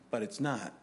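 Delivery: ordinary talk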